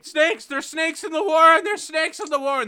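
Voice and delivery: shrieking high and loud in panic; panicked screaming. Retold or imitated. Rabbit screaming to the warren about snakes, shrieking. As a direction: scream the alarm at the top of your lungs. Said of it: "like, screaming".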